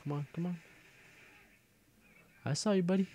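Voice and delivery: normal talking voice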